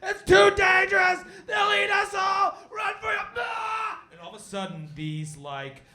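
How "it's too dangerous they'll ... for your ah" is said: high desperate shouting warning; panicked yell. Acted. Fleeing hunter yelling that they were lied to, screaming. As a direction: bellow the warning in terror. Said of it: "and he's like".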